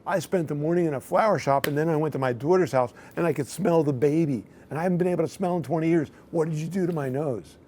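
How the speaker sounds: high-pitched, emotional mock plea; retold crying. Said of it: "But she starts crying, saying".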